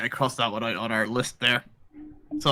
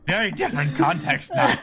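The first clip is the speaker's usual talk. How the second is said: laughing; 4 semitones higher than usual. Real, not acted. Laughs at own misreading.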